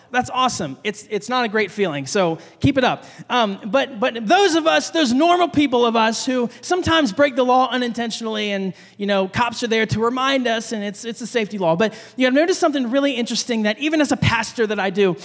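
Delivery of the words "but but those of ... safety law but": usual speaking voice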